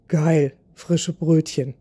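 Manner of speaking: normal talking voice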